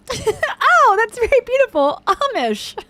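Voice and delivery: loud, very high-pitched gushing parody; exaggerated mock admiration. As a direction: gush it at the top of your range. Host parodies guest's admiring quote.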